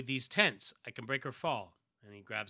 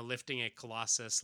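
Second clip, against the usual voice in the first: usual speaking voice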